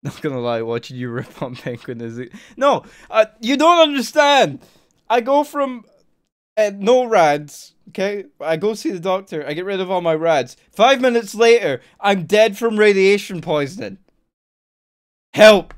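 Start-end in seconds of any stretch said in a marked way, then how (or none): 2.6-14.0 high, loud protest; worked-up gamer ranting, voice raised; exasperated rant. Real, not acted. Exasperated complaint to friend. 15.3-15.7 loud, high yelp; sudden mock-desperate shout; cry for help. Calls out in exasperation.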